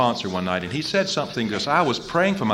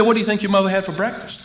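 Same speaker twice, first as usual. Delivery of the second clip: higher, faster counselor voice; counselor's question; 11 semitones higher, 4 dB louder, faster than usual. Retold or imitated. Counselor probing David, higher quicker voice.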